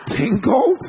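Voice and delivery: high, loud rising disbelief; incredulous echo. Retold or imitated. To buddy who said a silly word, incredulous. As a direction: repeat the silly word, astonished.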